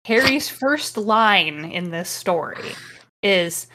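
ordinary delivery

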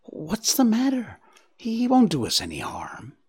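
high start, then a frightened whisper; trembling voice. A narrator's reading. Bambi calming Faline, frightened and trembling. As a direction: try to sound brave while scared. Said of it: "there was a tremble in his voice".

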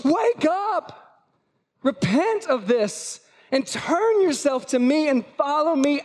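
sudden high shout, then slow and pleading; urgent wake-up call. Acted. Preacher voicing Jesus rousing a sleeping church, urgent high call. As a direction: yell it up high to wake them.

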